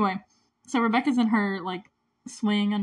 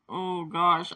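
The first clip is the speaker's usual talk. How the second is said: normal talking voice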